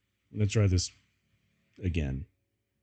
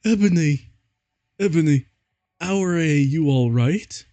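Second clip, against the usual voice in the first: worried Draco voice; 6 semitones higher, 4 dB louder than usual. Acted. Draco checks on Ebony after her vision, worried and raised. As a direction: call to her anxiously, hammed up. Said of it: "asked Draco in a worried voice".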